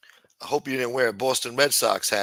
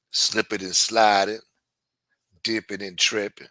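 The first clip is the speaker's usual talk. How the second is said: lower, put-on late-night radio host voice, smooth and laid back; 4 semitones lower than usual. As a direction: play a cool old-school late-night host welcoming the listeners.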